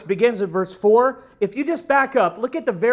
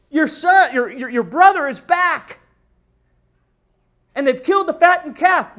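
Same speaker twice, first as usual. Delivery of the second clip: excited servant; 6 semitones higher, 7 dB louder than usual. Acted. Servant excitedly reports the news.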